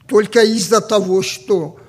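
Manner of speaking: normal talking voice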